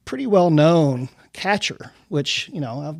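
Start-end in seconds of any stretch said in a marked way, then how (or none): none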